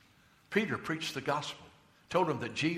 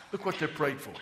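normal talking voice

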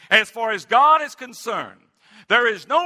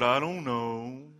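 lower, slow, puzzled mumble; deacon's reply; 7 semitones lower, 6 dB quieter, slower than usual. Retold or imitated. Deacon answering the pastor at the door, low and unsure. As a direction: drop it low and slow, clueless.

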